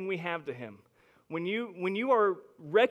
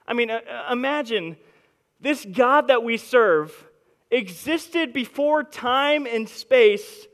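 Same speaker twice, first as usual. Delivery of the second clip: loud, driving preacher's voice; full-force preaching; 5 semitones higher, 11 dB louder than usual. Acted. Pastor preaching to his congregation, loud and driving.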